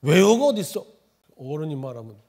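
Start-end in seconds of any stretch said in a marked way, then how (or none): 0.0-0.8 loud, higher imitation of a stern father; father's retort. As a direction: cut off a child's question as the father would. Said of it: "우리 아버지는 꼭 그렇게 대답해요". (아이 말을 자르는 아버지 흉내)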